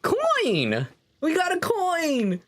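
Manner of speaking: very high, drawn-out cheer; excited celebration. Pretend. Player celebrating a gold coin, excited squeal.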